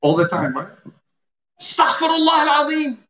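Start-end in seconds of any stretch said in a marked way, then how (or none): 1.6-3.0 high, fast exclamation of horror; mother's high-pitched reproach, acted out; shocked scolding mother. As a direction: cry out like a scandalized mum. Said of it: "and your mother, mashallah, is in the kitchen".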